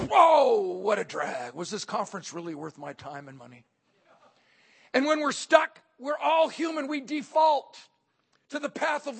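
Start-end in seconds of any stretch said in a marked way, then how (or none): 0.1-3.6 loud groan sinking into low complaint; hammed-up letdown, dropping low; deflated grumbling. Attendee once the conference is over, deflated grumbling.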